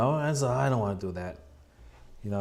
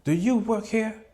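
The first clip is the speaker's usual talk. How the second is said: higher, indignant mock lady voice; sassy attitude; 9 semitones higher, 4 dB louder than usual. Lady in line snaps at the man behind her, indignant. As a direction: toss the question back with attitude. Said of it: "gave the guy an attitude".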